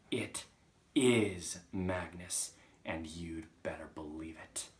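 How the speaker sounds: slow, weighty, dropping away; ominous ghostly message. Acted. Chalk answering the Trunchbull's denial, slow and ominous. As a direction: pronounce it like a warning from beyond.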